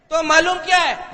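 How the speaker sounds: loud, fed-up demand; mock exasperated shout at the clueless guy. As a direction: bark it like an exasperated questioner.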